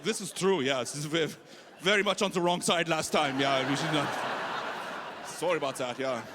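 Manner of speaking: lightly imitated German accent; sheepish imitation of a German soldier's accent. German soldier answering the comic's joke, apologetic German accent. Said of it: "The German soldiers laughed at that".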